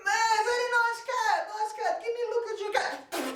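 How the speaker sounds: very high, sing-song sugary greeting; fake-sweet butcher. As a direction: lure him in with false charm. Butcher flatters the coat.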